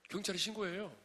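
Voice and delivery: normal talking voice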